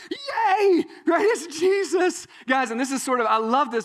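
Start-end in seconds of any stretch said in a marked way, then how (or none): 0.1-1.2 high, loud mock-baby cheer; gleeful cheer. Unborn John the Baptist sensing Jesus, gleefully cheering. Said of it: "and he's just like". 1.3-2.3 high, loud playful thrill; gleeful excitement. Acted. Unborn John the Baptist recognizing Jesus, gleefully excited. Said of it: "and he's just like".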